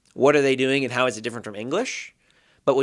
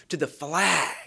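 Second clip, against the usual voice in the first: "flag" hit and falling; stress on flag; 4 semitones higher, slower than usual. Shown as a demonstration. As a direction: land hard on "flag". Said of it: "emphasize a different word every time".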